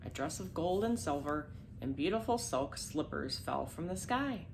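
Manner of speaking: ordinary delivery, nothing marked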